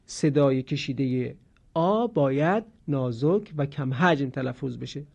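usual speaking voice

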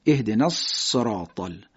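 "اهدنا الصراط" slowly, ص hissed; slow whistled sad. Shown as a demonstration. Teacher reciting slowly to show the whistle. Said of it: "صدای صفیر شنیده بشه".

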